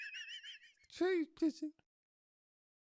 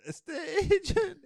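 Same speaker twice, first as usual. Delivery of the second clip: hammed-up crying wail; comic sob story, voice cracking; mock sobbing; 3 semitones higher than usual. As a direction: blubber it out like a botched patient. Wife sobbing after botched surgery.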